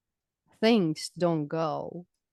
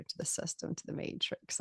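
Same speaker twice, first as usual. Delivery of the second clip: whispered aside; 12 dB quieter than usual. Real, not acted. Drops to a whisper.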